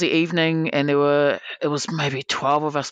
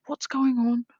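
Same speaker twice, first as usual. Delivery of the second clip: higher, startled questioning; mock bewildered alarm; 8 semitones higher, slower than usual. Player watching a tantrum, higher puzzled alarm. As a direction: sound lost and a bit alarmed. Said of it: "And I was like".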